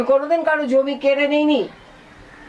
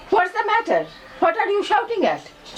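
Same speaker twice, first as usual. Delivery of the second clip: surprised father's voice; 7 semitones higher, much slower than usual. Acted. Father bursts in on quarrel.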